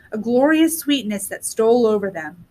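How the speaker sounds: ordinary delivery, nothing marked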